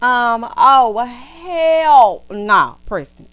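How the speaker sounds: loud, long-drawn incredulous no; outraged refusal. Acted. Viewer objects to Preston's plan, loud outraged refusal. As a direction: stretch it out big and loud, scandalized.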